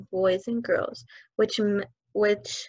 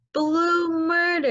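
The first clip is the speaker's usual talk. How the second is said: high, slow mock scream; child screaming while being spun; 8 semitones higher, slower than usual. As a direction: wail it while being swung. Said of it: "Amanda was screaming".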